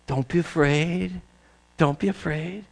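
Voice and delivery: soft, slow comfort; reassuring. Acted. Angel calming the shepherds, soft and slow. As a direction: soothe a frightened heart. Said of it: "What was the command?".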